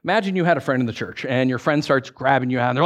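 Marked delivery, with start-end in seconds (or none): none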